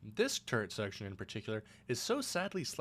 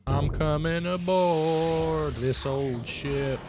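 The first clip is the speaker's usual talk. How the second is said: silly voice; 4 semitones higher, 8 dB louder, much slower than usual. Pretend. Narrating in a silly voice.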